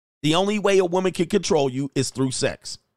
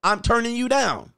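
mock indignant wife; 8 semitones higher than usual. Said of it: "she'll suggest".

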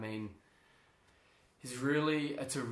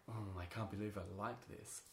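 subdued, softer mock disbelief; quietly disown an old favourite, as someone else; imitating disenchanted adults; 7 dB quieter than usual.

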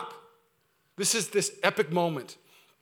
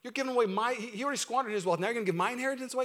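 higher-pitched, indignant complaint; frustrated older brother; 7 semitones higher than usual. Acted. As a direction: protest the unfairness without shouting. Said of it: "justified why he's so frustrated".

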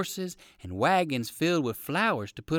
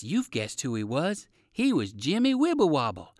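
ordinary delivery

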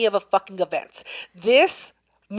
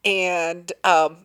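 ordinary delivery

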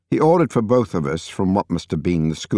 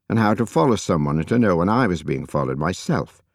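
usual speaking voice

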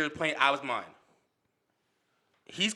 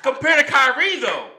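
laughing insistence; 8 semitones higher, 6 dB louder, slower than usual. Real, not acted. Laughing insisting over friends' teasing.

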